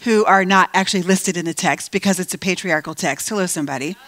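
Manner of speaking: ordinary delivery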